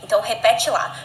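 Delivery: ordinary delivery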